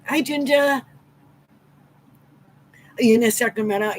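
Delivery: ordinary talk, nothing marked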